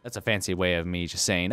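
ordinary talk, nothing marked

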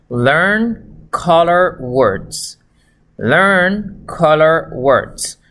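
very slow, word-by-word English; slow model reading. Shown as a demonstration. Teacher reads English instructions to learners, slowly. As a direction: read it out slowly for beginners, each word separate.

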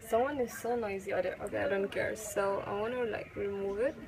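stiff lips, limited jaw movement; careful speech under a face mask; through a sheet mask. Real, not acted. Seen 1: Wet sheet mask covers face while she speaks, peeled off near 24s.

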